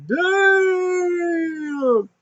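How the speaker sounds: very high, long-held squeal; retold falsetto exclamation of shock; mock shock. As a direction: stretch it out high in hammed-up amazement. Own reaction, retold.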